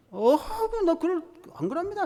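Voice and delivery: high, insistent protest; Peter protesting. Retold or imitated. Pastor voicing Peter swearing he won't betray Jesus, high and insistent. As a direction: deny it with wide-eyed earnest.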